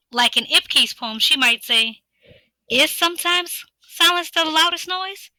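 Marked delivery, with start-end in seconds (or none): 2.7-5.3 rising question tune on "noise"; question inflection. Shown as a demonstration. Teacher showing a poem line as a question. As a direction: ask "Is sometimes silence the loudest noise?" with a lifted question tune. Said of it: "That's a question".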